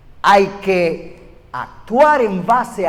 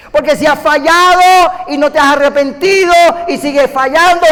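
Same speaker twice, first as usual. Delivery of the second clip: impassioned preacher pressing each line; full-force preaching; 5 semitones higher, 3 dB louder than usual. Real, not acted. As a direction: preach it out with rising fire.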